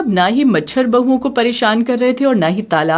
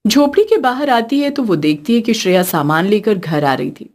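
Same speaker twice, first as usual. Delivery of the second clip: ordinary delivery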